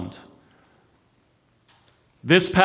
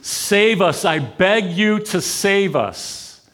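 higher, slow begging plea; pleading cry; 6 semitones higher, slower than usual. Retold or imitated. The crowd begging God to save them, pleading. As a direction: beg for rescue, drawn out.

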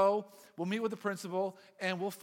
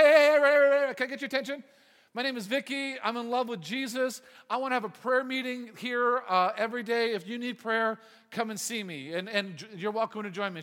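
loud, higher call for attention; acted-out public announcement, raised voice; 3 semitones higher, 9 dB louder than usual. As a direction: call out to a crowded cafeteria. Acted girl addressing whole cafeteria from tabletop.

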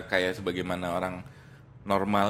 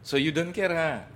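teasing stranger; 8 semitones higher, slower than usual. Retold or imitated.

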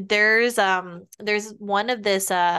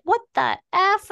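higher, louder mock squawk; bird voice; 10 semitones higher, 4 dB louder than usual. Retold cockatoo losing its mind, mock squawk. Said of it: "and he's like".

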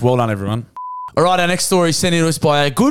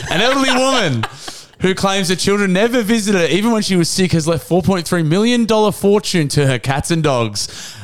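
measured newsreader delivery, slightly raised; news read; 5 semitones higher than usual. Acted. Host reading the story to his co-host, newsreader tone. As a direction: read it like a straight news bulletin.

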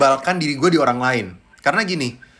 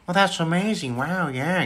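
higher, airy drawn-out amazement; Owen Wilson; 5 semitones higher, much slower than usual. Shown as a demonstration. Impressionist as amazed film star, Owen Wilson drawl. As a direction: stretch each wow in mild, lazy wonder. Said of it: "Owen Wilson".

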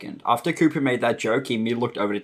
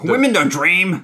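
higher, louder sarcastic caricature of a chauvinist; mock sexist husband; 6 semitones higher, 6 dB louder than usual. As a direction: sneer it as a ridiculous sexist husband.

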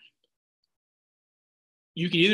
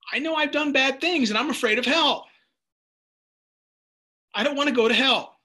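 child's fear, voiced high; 8 semitones higher, 4 dB louder than usual. Son voiced, fearful child.

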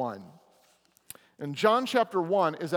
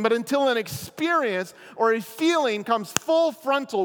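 preaching; 7 semitones higher, 6 dB louder than usual. Acted. Pastor driving the lesson home to the congregation, loud preaching. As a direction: drive the lesson home from the pulpit. Preaching the point at full force.

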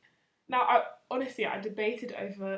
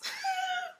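helpless high-pitched laugh; high giggle. Real, not acted. Reading her old reply aloud, cracking up laughing. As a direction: crack up at your own embarrassment.

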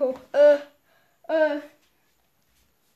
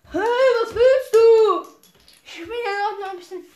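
loud gruff guy; 7 semitones higher, 10 dB louder than usual. Acted. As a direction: bellow it out, then trail off. Big guy challenged.

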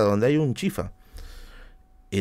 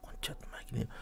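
muttered curse; 20 dB quieter than usual. Real, not acted.

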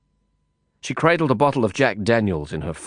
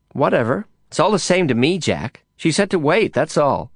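a hint of an offhand woman, higher; lightly voiced woman; 6 semitones higher than usual. A narrator's reading. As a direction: voice her higher, a bit dismissive. Woman shrugs off Lin.

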